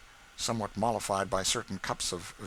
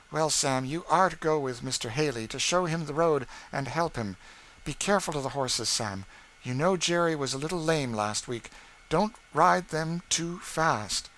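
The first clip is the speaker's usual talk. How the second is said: mistress, emphatic; 7 semitones higher, 5 dB louder than usual. A narrator's reading. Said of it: "with a low voice and strong emphasis".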